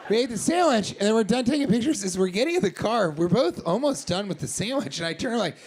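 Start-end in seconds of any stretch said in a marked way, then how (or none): none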